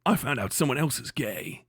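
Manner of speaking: low, soft mocking quote; mock gossip. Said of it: "that says".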